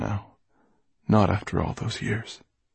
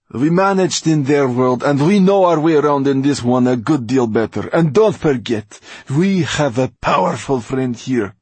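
domineering Mortola, scolding; 5 semitones higher, 8 dB louder than usual. Acted. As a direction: scold him loudly, then ease down into deliberate menace. Voices Mortola scolding Basta.

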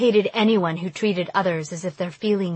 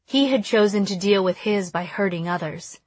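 plain reading voice

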